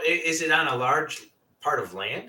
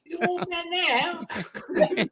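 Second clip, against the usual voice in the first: put-on much higher, very slow old lady voice breaking into a laugh; much slower than usual. Host echoing the ghost wife, laughing old lady voice. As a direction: drag it out like a shrill granny, laughing. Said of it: "an old British lady".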